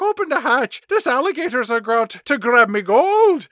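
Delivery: high, loud Irish leprechaun voice; mock leprechaun accent. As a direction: beg like a panicking leprechaun. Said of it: "he doesn't sound like a leprechaun".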